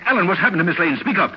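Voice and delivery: high, urgent questioning; impatient demand. Acted. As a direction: demand an answer from the dithering valet. Demands news of missing woman.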